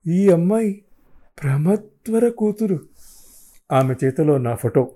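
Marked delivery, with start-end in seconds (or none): 0.1-2.8 shocked disbelief. Acted. Shocked inner question.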